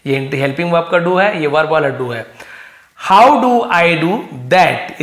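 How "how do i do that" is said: slow, loud dictation read. Shown as a demonstration. Teacher reading the story line aloud to students, slow and loud. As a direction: read it out slowly and clearly.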